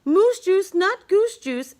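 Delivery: plain reading voice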